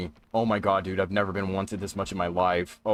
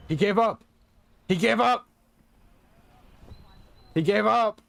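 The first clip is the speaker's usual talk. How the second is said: high, louder cheer of relief; elated relief; 12 semitones higher, 4 dB louder than usual. Real, not acted. Streamer relieved the hunter gave up, high happy cheer. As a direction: cheer that he's gone.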